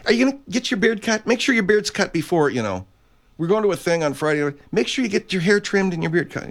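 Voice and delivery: high, quick nagging imitation; women in his life nagging about his beard, in a high quick mock voice; nagging woman. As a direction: mimic a nagging partner, high and fast. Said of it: "any woman ... will say".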